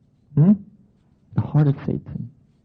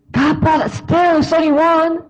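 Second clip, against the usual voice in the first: pleading person. Retold or imitated. Person bargaining with God, high and pleading. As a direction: whine to God for a loophole.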